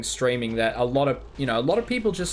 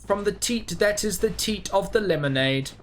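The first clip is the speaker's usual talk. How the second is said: mock benevolent deity; 3 semitones higher than usual. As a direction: intone it like a generous god addressing all the lands. Plays a benevolent giver of wine.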